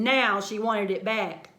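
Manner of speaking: plain reading voice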